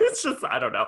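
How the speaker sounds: normal talking voice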